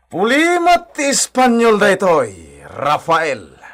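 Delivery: slow, high sneering mock; slow high taunt. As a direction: drag it out, mocking and superior. Villain gloats, mocking Spanish.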